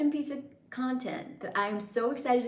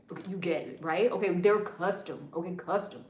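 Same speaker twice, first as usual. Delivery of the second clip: usual speaking voice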